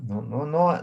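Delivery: ordinary delivery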